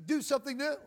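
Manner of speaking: ordinary delivery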